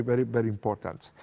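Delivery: ordinary talk, nothing marked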